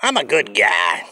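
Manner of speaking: ordinary talk, nothing marked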